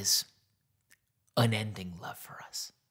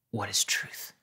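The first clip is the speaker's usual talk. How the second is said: sarcastic Pilate; slower than usual. Retold or imitated. Pilate mocking Jesus with a hushed sneer. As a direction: toss the question off in contempt. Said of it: "It was probably sarcastic".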